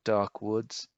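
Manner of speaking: ordinary delivery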